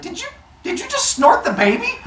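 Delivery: high, loud disbelief; shocked mother. Retold or imitated. Rhea catching her husband swallowing the baby, high shocked cry. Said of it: "She's like".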